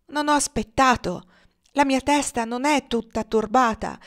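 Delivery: loud, high-pitched, impassioned tirade; vengeful outburst. A narrator's reading. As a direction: cry out for vengeance with fierce fervour. (sfogo veemente e vendicativo)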